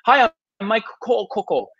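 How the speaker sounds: fumbled intro. Shown as a demonstration. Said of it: "you try to say your name".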